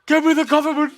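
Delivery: shrill shouted movie line; shouting. Acted. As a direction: yell it like a cartoon general. Shouted movie quote bit.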